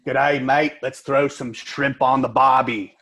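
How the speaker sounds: a hammed-up Australian accent, slow; American doing a mock Aussie accent. Shown as a demonstration. American coach shows his Aussie accent to the group, slow and hammed-up. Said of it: "I'll give you a taste of my Aussie accent".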